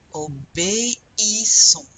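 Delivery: "Obéissons" slow and loud, syllable by syllable; drawn-out o-bé-i-ssons for "Obéissons"; E and I separated. Shown as a demonstration. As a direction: give é and i in "Obéissons" a full syllable each. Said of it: "The I is pronounced like a".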